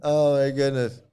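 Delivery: ordinary talk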